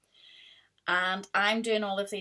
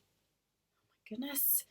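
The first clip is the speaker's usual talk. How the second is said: whispered aside; 7 semitones higher, 11 dB quieter, slower than usual. Real, not acted. Exasperated at puppy, aside.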